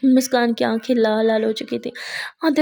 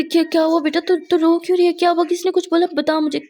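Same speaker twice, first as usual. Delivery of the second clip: higher, louder, urgent questions; worried friend questioning the crying girl; alarmed concern; 5 semitones higher, 4 dB louder than usual. Acted. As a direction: demand to know who hurt her.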